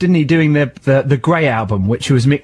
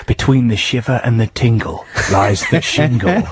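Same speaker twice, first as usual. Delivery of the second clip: movie trailer voice. Pretend. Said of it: "you could imagine the poster".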